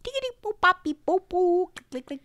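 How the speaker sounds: high beeps then clicking; computer noises. Pretend. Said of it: "computer noises".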